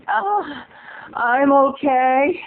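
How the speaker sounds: high, slow panting imitation; mock out of breath. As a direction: puff through it as if winded. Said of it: "You just sound so out of breath".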